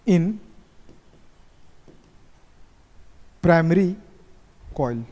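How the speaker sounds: word-by-word dictation with long pauses; measured, spaced-out classroom dictation; slow dictation. Real, not acted. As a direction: dictate slowly for note-takers.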